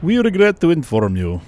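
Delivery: hammed-up Russian officer announcing bad news; loud put-on Russian accent. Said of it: "That's too Russian sounding".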